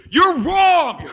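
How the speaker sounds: very loud, high comic yell; outraged shout. Acted. Servant to master after a quick count, shouting. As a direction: explode in mock outrage.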